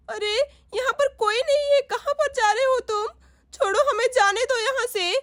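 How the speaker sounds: high, tearful mother pleading with the robbers; a mother crying out in fear at gunmen; frightened mother. Acted. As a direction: voice a scared mother close to tears.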